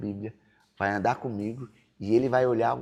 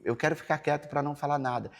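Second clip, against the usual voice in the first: ordinary delivery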